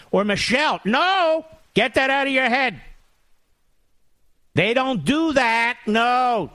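high, stretched-out scandalized protest; mock horrified denial. Host mock-defends the Obamas from toilet jokes, high mock horror. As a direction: refuse the thought in high mock outrage.

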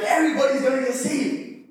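high-pitched revival enthusiasm; retold preacher's excitement, pitched well up; eager preaching. Preacher imagining the whole crowd saved, eagerly.

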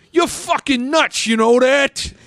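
high, agitated New Yorker; mock New York accent. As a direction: shoot upward in exasperated, hammed-up New Yorkese. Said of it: "in that accent".

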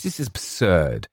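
flustered, high-pitched protest; indignant cry. A narrator's reading. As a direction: sputter with outraged disbelief. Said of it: "cried James".

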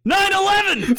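high-pitched comic yell; over-the-top screamed reveal; shouted punchline. Acted. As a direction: blurt it out in a sudden high shout. Shouted punchline in Canadian bit.

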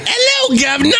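very high squeaky Cockney imitation; mock Cockney. Co-host teases the host by mimicking his Cockney, squeaky mock Cockney. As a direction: tease him by parroting his Cockney greeting. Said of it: "Did you say".

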